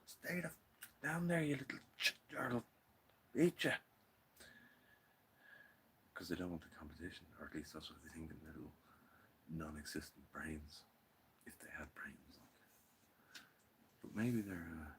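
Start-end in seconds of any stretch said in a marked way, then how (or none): 0.0-3.7 high, loud threatening cartoon voice; a tiny plant snarling at its neighbour; high mock tough-guy voice; bullying seedling voice. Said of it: "you'll be like". 11.4-13.4 unvoiced whispered aside; soft unvoiced whisper. Real, not acted.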